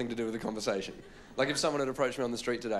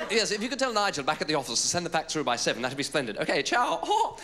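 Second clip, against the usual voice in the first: imitated efficient phone chatter; woman on phone; 4 semitones higher, 4 dB louder than usual. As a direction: mimic an Englishwoman signing off a mobile call. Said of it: "heard this woman answer and just go".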